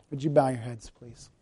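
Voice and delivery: soft, hushed closing invitation; quiet prayerful appeal. Acted. Pastor leads closing prayer reflection.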